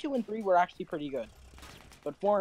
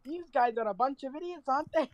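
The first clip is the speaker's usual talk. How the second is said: put-on a light Australian accent, pitched higher; a sniper's Australian quip, raised pitch; a hint of Australian mockery, higher; 8 semitones higher than usual. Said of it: "an Aussie accent".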